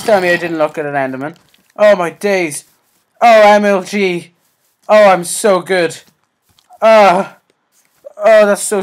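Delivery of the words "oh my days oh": ordinary talk, nothing marked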